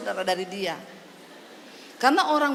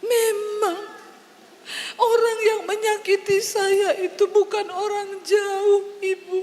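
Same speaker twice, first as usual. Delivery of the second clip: higher, voice near tears; tearful woman; 6 semitones higher than usual. Retold or imitated. Wounded woman admitting family hurt her, close to crying. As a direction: confess the hurt through tears. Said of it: "tiba-tiba dia begini".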